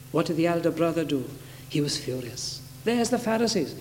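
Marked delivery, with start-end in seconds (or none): none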